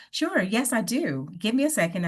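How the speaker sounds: smiling and confident. Shown as a demonstration. Candidate agreeing to talk with the recruiter, upbeat and confident. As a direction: say yes with a smile in the voice. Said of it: "speaking with a smile on my face and I sound more energetic".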